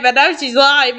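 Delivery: ordinary delivery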